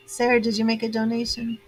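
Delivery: usual speaking voice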